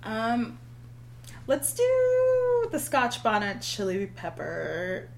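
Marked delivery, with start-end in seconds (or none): none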